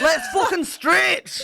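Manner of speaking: hammed-up Scottish instructor shout; slow, high, loud Scottish rallying cry; Scottish accent. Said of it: "The Scottish version".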